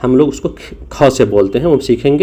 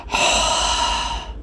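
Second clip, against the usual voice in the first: airy unvoiced haah from the middle of the throat, like after a cold drink; relieved breathy haah after a cold drink; 8 dB quieter than usual. Shown as a demonstration. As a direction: exhale a refreshed haah from the throat. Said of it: "तो ये जो ... की आवाज़ निकली".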